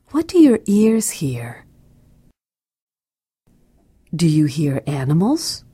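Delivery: hushed unvoiced question; whisper. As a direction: whisper it as if listening closely. Listening cue in meditation.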